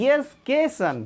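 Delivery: एजुकेशन said slowly and carefully; correct pronunciation. Shown as a demonstration. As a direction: spell out एजुकेशन slowly for students. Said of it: "देखिए यहाँ पर देखिए एजुकेशन".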